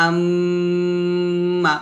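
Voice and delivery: আম্মা with a long nasal hummed m; slow আম্মা, humming through the nose; ghunnah demo. Shown as a demonstration. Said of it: "নাকের বাসি হয়তো গুন্না উচ্চারিত হয়".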